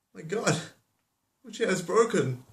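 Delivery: high, hammed-up wail of dismay; mock dismay. Bear discovering a broken chair, high mock dismay. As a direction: cry it high, aghast at the damage.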